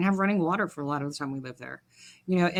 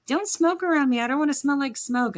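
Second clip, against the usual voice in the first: high, whiny mock self-scold; prissy goody two shoes; 8 semitones higher than usual. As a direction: imitate your younger prim self, pitched way up.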